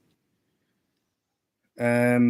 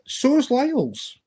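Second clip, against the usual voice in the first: squeaky put-on voice; 14 semitones higher than usual. Voices a figure's reply.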